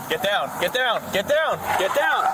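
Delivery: shouting orders over battle. Real, not acted. Officers storming a suspect.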